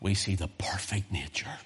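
softly whispered, no voice. Real, not acted. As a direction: breathe the words out in a hush. Hushed moment in sermon.

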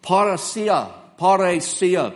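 parousia said slowly, PAH-roo-SEE-ah; careful pronunciation. Shown as a demonstration. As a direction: spell out parousia syllable by syllable. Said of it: "The word here for confidence is a word".

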